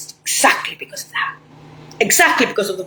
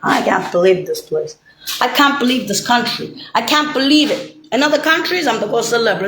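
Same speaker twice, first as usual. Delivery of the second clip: normal talking voice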